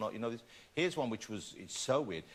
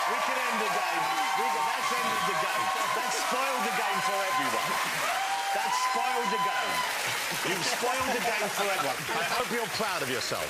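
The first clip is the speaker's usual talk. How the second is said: higher, louder mock telling-off; hammed-up disapproval, a bit higher and louder; mock scolding; 6 semitones higher, 3 dB louder than usual. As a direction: playfully scold them for ruining it. Mock scolding for spoiling the game.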